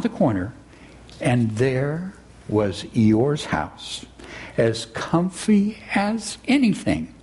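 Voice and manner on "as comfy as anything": ordinary delivery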